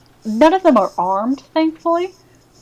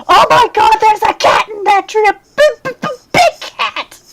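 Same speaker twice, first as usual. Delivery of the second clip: high, loud townsperson's shriek; panicked scream; 12 semitones higher, 7 dB louder than usual. Acted. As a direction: scream in panic, stammering over the words. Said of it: "you hear someone scream".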